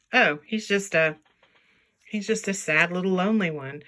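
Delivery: ordinary talk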